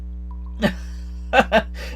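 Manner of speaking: villain's cackle. Acted. Villain chuckles at the captive he holds.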